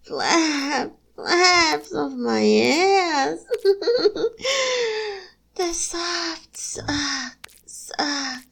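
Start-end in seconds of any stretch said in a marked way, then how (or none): 3.5-5.2 breathy giggle. Acted. Laughs mid-scene.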